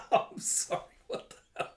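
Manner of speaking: gasping words through laughter; real laughing fit. Real, not acted. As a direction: crack up while apologizing. Narrator cracks up at cap.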